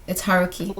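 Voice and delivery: usual speaking voice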